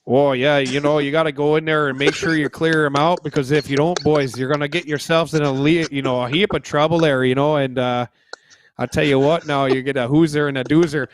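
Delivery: a hint of a Canadian hockey coach; Canadian accent. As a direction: lay on the Canadian coach twang lightly. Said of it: "Talk with a Canadian accent".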